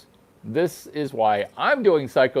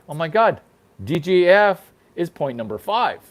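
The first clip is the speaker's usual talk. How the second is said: normal talking voice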